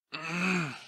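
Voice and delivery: loud, effortful grunt; strained grunt. Acted. Narrator fighting the onslaught, strained grunt. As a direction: grunt against a heavy blow. Straining against the magical blast.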